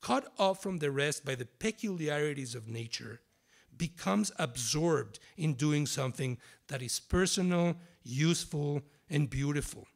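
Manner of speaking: dramatic literary reading with long pauses. Acted. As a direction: perform it like a spoken-word piece for an audience. Author reads literary text aloud.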